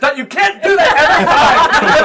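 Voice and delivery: high, loud, slow laughing protest; exasperated companion. Acted. GM voicing a companion protesting the bet of the ring. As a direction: complain while laughing. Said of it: "the other one goes".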